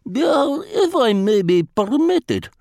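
put-on lightly accented, formal and unhurried Vladimir; a polite spy choosing words with care; foreign diplomat voice. A narrator's reading. Vladimir voiced in read story.

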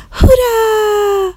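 high, drawn-out joyful cheer; happy hooray. Acted. Children cheering at the teacher's news, long high hooray. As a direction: stretch the cheer up high like delighted kids. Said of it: "با خوشحالی گفتن".

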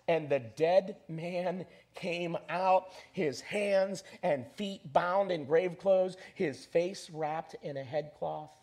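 slow, deliberate proclamation that settles at the end; dramatic scripture reading. Acted. Preacher reading the raising of Lazarus, dramatic scripture reading. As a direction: read the verse out slowly, phrase by phrase.

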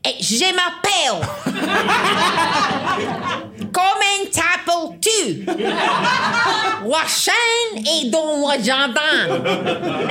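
high, loud Scottish-French teacher voice with a laugh; loud high Scottish-accented French, slow at the end; imitated French teacher. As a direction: mimic the strict teacher correcting the pupil's French. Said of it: "and she'd go".

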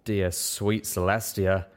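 plain reading voice

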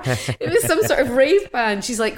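normal talking voice

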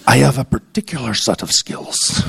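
lightly voiced movie tough guy; a hint of low menacing threat; mock Liam Neeson menace. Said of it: "I turn into Liam Neeson".

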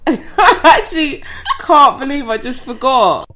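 laughing. Real, not acted. Laughing at forgetting.

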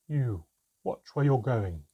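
loud, slow warning; a strong, slow voice calling out; strange commanding voice. A narrator's reading. Unseen creature warns someone to watch out, strange, strong voice. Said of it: "a strange voice. It had a strength and depth".

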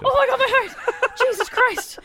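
high, laughing shaken relief; heart-pounding exclamation with laughter. Real, not acted. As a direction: gasp it out, giddy with relief. Relief after escaping a shock.

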